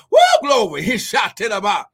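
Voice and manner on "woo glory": higher, louder cry of glory; ecstatic woo. Real, not acted. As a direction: burst out in praise. Preacher's ecstatic exclamation.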